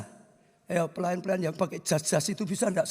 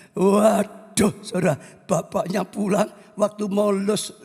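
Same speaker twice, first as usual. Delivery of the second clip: ordinary delivery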